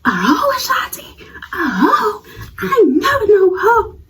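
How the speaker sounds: high breathy mocking imitation; mock outraged rival. The rival protesting the insult, mocked high. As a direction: squeak her offended reply.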